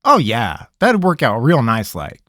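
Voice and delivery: sarcastic. Acted. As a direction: drip with sarcasm. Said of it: "said sarcastically".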